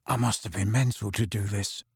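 low, hushed and rasping; croaky whisper. Acted. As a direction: croak it out under your breath. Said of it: "he said in a croaky whisper".

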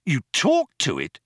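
high-pitched, incredulous question; astonished exclamation. A narrator's reading. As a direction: exclaim it in disbelief. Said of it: "exclaimed Ford".

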